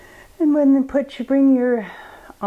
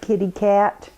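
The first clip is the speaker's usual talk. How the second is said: ordinary talk, nothing marked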